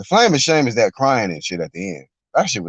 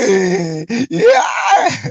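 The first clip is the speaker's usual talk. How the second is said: mock crying wail. Mocking the boxer's crying at the end with a wail. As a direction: howl it like a crying fighter.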